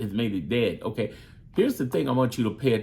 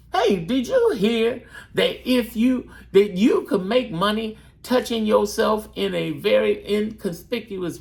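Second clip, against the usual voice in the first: 1 hyped-up gossip; 8 semitones higher than usual. Shown as a demonstration. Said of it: "all you're doing is saying".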